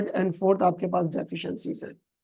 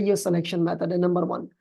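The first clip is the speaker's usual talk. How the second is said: normal talking voice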